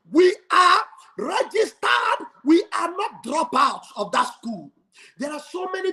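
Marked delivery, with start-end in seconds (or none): none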